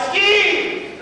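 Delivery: high yelled warning; mock yell. Man on the slope yells a warning, high mock yell. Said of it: "I heard a man yell".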